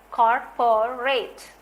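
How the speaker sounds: Corporate slowed into three beats, cor-po-rate; slow, spaced cor-po-rate; syllable beats. Shown as a demonstration. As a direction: beat out cor-po-rate slowly. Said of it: "three syllable".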